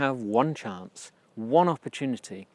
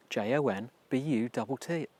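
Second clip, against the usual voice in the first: ordinary talk, nothing marked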